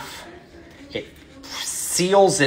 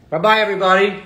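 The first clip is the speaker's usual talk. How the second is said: cheery goodbye; 8 semitones higher, 6 dB louder, faster than usual. Real, not acted. Signing off the vlog.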